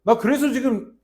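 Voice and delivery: loud, high, emphatic class talk; teacher calling to class. Real, not acted. Teacher asking class who is missing, loud projected voice. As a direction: project over the room. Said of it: "teacher addressing students now".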